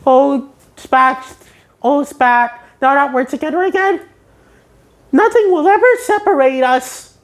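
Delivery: high, excited cartoon voice; elated SpongeBob. SpongeBob reunited with spatula, high and elated. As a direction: gush with joy in a high voice. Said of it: "SpongeBob's elated and says".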